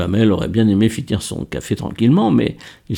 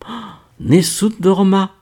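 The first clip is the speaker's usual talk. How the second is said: excited cry; 9 semitones higher than usual. A narrator's reading. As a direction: cry out in sudden recognition. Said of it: "cria Laure".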